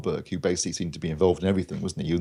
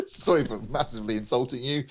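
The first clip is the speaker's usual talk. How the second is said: laughing; 6 semitones higher than usual. Real, not acted. Apology through laughter.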